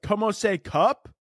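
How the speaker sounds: slow, clueless American attempt at Spanish; American-accented Spanish. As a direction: imitate a tourist's American Spanish, drawn out. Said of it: "you probably sounded so American".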